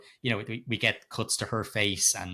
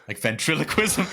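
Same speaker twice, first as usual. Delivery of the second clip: imitating the therapist's question, higher and louder; hammed-up quote of the therapist asking about ventriloquism; mock incredulous question; 6 semitones higher, 4 dB louder than usual. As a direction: pitch it up as if the question is absurd. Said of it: "and goes".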